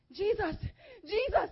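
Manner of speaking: loud, high calling out for help; panicked cry. Retold or imitated. Storyteller trying to tame the flames, loud panicked cry. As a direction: cry the name out in re-enacted panic. Retold panicked cries at the fire.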